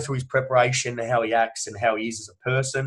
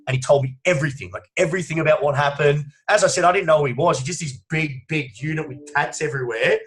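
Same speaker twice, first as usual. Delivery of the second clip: ordinary talk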